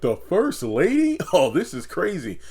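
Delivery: high, stretched shocked gasp of a question; stunned disbelief at the news. Retold or imitated. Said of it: "Dale is, like, shocked".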